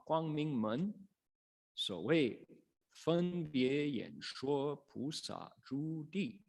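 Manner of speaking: slow, measured Chinese sutra chant; sutra recitation. Acted. Teacher reads the Chinese sutra to listeners, slow chant. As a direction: intone each phrase slowly like scripture.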